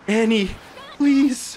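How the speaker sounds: high, loud plea at the screen; urgent plea to Annie; pleading. Real, not acted. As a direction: beg the character to come through.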